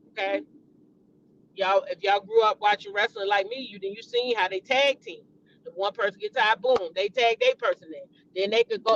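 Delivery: usual speaking voice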